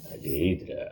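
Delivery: hushed calm answer; said silently. Acted. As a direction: barely voice it, low and still. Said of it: "ఎంత సైలెంట్గా చెప్పాడు".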